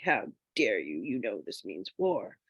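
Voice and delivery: playing up fake fury at her sister; mock outrage.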